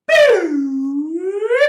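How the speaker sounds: high, loud "boo" swooping down and back up; loud high vocal swoop; slide whistle imitation. Shown as a demonstration. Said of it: "make your voice sound just like my slide whistle".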